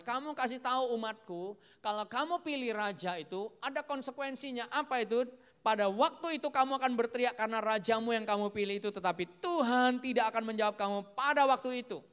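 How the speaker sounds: loud, high prophetic warning; forceful preaching. Acted. God warns the people through Samuel, forceful preaching. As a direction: thunder out God's warning to the people.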